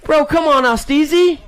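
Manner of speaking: teasing. Acted. Announcer ribbing a rider over a bouncy first jump. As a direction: rib a buddy for bouncing.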